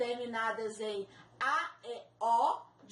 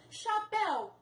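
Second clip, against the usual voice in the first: Chapéu higher and marked, with the final péu stressed; stressed syllable shown; 8 semitones higher, slower than usual. Shown as a demonstration. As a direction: lift Chapéu, hitting péu. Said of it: "Oxítona terminada em ditongo aberto". (Chapéu, mais agudo, com força no péu)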